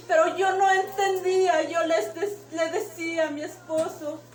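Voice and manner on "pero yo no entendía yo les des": ordinary delivery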